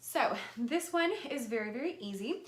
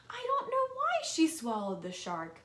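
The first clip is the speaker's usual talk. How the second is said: put-on reading to children; 3 dB louder than usual. Reads picture book aloud.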